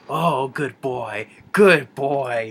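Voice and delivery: slow, sly praise of the dog; sly good boy. Retold or imitated. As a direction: coo it slowly like a smug villain. Villain praises dog slyly.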